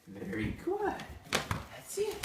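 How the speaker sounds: very high, soft praise for the dog; high cooing dog praise. Real, not acted.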